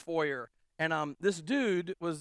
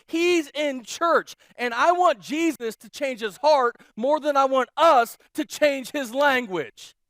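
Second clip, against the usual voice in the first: loud, higher, insistent, slowing on key lines; emphatic correction; 4 semitones higher, 6 dB louder than usual. Retold or imitated. Pastor correcting the offended churchgoer, loud and insistent. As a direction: drive the point home hard. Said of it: "And I'm like".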